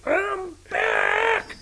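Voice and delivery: high announcer-style shout of return; hammed-up comeback cry. Host announcing the show's return, high hammed cry. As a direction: belt it like a wrestler returning.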